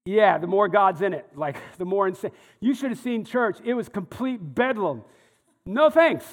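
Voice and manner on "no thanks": loud, high-pitched "no thanks"; mock refusal. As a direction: recoil from the chaos, pitched up and loud. Mock rejection of chaotic church.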